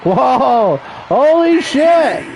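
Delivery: drawn-out, slowed-down holy shit; real astonishment, words stretched slowly; stunned surprise. Real, not acted. Seeing surprise in the ring.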